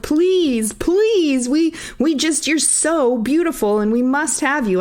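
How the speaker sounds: high, begging suitor voice; pleading suitors. Retold or imitated. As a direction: beg like a lovestruck suitor. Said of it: "three handsome men who were like".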